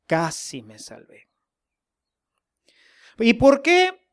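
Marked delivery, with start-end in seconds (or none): 0.1-1.3 whispered, much softer lament. Pretend. A damned soul whispering his loss, hushed whisper. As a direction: breathe the words out with no voice.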